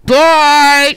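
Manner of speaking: high, blaring drawn-out yell; super loud shout. Retold or imitated. Woman shouting down the driveway at leaving guest, loud yell. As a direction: holler it as if through a megaphone. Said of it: "super loud".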